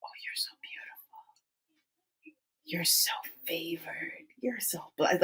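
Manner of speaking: put-on child's soft whisper to herself. Little girl whispering praise to herself in the mirror. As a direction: breathe it out in a tiny whisper. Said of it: "she would talk in a whisper".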